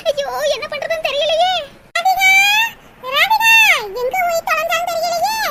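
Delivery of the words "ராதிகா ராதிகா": calling out. Acted. Mother calling lost daughter.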